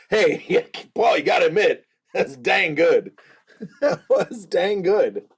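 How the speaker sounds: real laughter breaking into the words; laughing. Real, not acted. Host admitting to a viewer the joke landed, laughing. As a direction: concede it through chuckles.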